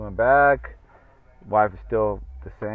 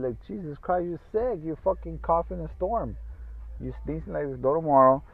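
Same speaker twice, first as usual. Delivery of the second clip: exasperated scolding; 7 semitones higher, 4 dB louder than usual. Real, not acted. Scolding sick wife.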